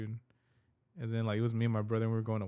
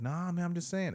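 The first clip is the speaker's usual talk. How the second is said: imitated backpedalling, pitched higher; retold older dude; 6 semitones higher than usual. Older dude backing off after accusing them, lightly imitated higher voice. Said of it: "he's just like".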